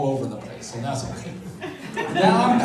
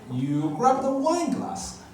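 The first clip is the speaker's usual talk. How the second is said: a hint of a Chinese mother's accent, higher; lightly put-on Chinese accent as the mother; mother delivering the verdict in a light Chinese accent; 7 semitones higher than usual. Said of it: "the Chinese accent".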